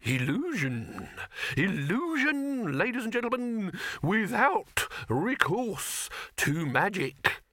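high, slow, grand showman. A narrator's reading. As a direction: announce it like a fairground barker. Showman addresses the crowd.

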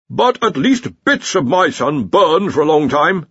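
slow, high petulant defence; sulky defence. A narrator's reading. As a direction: whine it defensively. Dean defending himself.

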